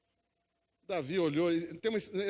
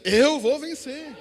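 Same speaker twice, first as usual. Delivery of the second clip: high, loud, drawn-out proclamation; rousing pulpit declaration, slow and raised; declared with faith; 7 semitones higher, 9 dB louder, much slower than usual. Shown as a demonstration. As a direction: stretch it out loudly as a cry of faith. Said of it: "Diga com fé aí".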